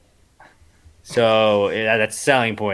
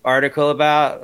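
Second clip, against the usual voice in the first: usual speaking voice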